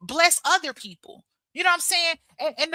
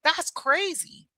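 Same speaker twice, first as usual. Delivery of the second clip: amused detachment; 3 semitones higher, 4 dB quieter, much slower than usual. Retold or imitated. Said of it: "just smiling like".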